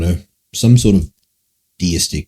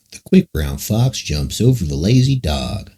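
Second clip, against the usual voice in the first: slow Southern American accent; 3 semitones higher, slower than usual. Shown as a demonstration. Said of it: "You do an American accent impression?".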